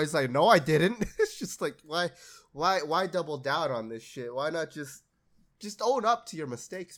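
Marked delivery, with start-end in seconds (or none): 0.3-1.0 loud, higher, indignant no; mock stubborn denial. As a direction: dig in like a caught liar. Acted lady denying, doubling down.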